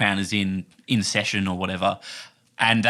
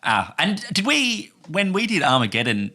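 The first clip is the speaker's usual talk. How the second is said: ordinary talk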